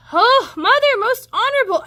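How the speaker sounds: put-on high, loud breathless little boy; out-of-breath child calling; panting boy. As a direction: gasp it out like you ran all the way. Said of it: "he panted".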